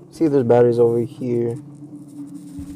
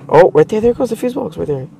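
excited; 7 semitones higher, 3 dB louder than usual. Real, not acted. Excited find.

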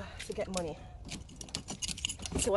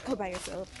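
voice of the universe; 3 semitones lower, 6 dB quieter than usual. Pretend. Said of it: "The universe is like".

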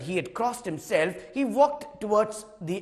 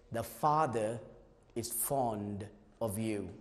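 tender quiet line; 8 semitones lower, 9 dB quieter than usual. Acted. Closing line of the story.

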